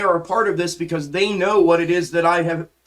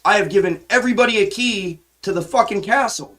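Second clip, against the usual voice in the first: normal talking voice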